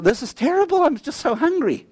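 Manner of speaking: mock complaint. Retold complaint to the Lord.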